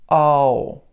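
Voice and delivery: the wrong "ow" as the students said it; "ow" with the wrong vowel, not American; wrong vowel demo. Shown as a demonstration. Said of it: "this O W right here was".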